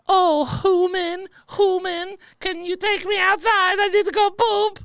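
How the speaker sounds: put-on very high, slow, squeaky little old lady; mock the cutesy dog voice way up high; little Russian lady voice. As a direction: people voicing their dog as a tiny Russian lady. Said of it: "talking like a little Russian lady".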